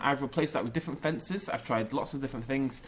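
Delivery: normal talking voice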